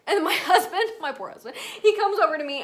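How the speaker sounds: normal talking voice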